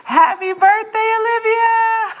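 a high, loud, drawn-out birthday shout. Real, not acted. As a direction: yell it to the whole stream for your sister. Surprise birthday shoutout to sister.

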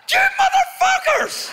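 very high, slow shrieking yell; high-pitched yell. Retold or imitated. Upset diver yelling at the boat kids, very high shriek. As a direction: screech it way up high in outrage. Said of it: "I went".

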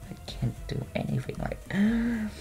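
soft, voiceless self-reproach; dejected whisper. Real, not acted. Player gives up on own bad accent, dejected whisper. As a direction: whisper it to yourself in defeat.

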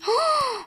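high, airy gasp of awe; amazed gasp. Real, not acted. Player showing friends the giant tsunami, amazed gasp. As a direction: gasp at a stunning sight. Sees the wave again.

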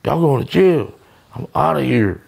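high, excited mock panic; hammed-up high-pitched warning; alarmed partner. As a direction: imitate the partner freaking out. Colombian partner voiced in story.

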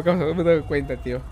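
usual speaking voice

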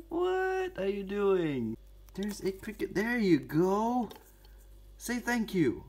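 high coaxing pet talk to tarantula; baby talk to pet. Pretend. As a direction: coo at the spider like a baby. Talking to pet spider.

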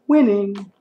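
high, loud, held catchphrase; catchphrase call. Pretend. Catchphrase called out.

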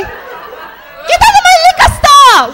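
high, loud, slow put-on yell; mock Korean shopkeeper. Store owner chasing customers out, loud put-on yell. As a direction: bellow like an angry store owner.

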